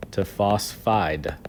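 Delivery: slow down on phosphide so they hear it; slow stressed ending. Shown as a demonstration. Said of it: "change it from phosphorus".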